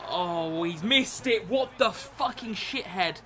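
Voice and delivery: high, loud, slow outburst; swearing at a missed chance; frustrated rage. Real, not acted. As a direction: rage at the miss.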